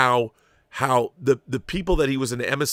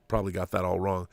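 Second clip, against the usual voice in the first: normal talking voice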